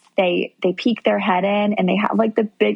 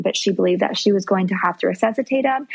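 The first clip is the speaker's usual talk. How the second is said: usual speaking voice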